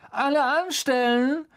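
high, loud call to a crowd; taunting crier's yell; booming call. A narrator's reading. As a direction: holler it out mockingly, high-pitched. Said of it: "dröhnte Malfoy in die Menge hinein".

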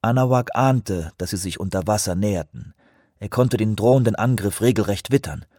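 plain reading voice

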